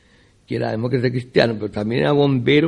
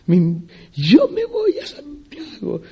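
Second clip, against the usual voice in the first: much higher, slow, laughing self-mockery; mock proud announcement; 12 semitones higher, much slower than usual. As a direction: lampoon her girlish boast, drawn out and giggling. Imita su propio anuncio burlón. (imitándose a sí misma, aguda, lenta y riendo)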